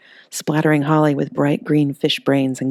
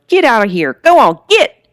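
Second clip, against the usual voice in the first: much higher, louder shooing shout; yelling; 7 semitones higher, 8 dB louder than usual. Acted. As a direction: chase the monsters away. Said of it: "leaned out the broken window and yelled".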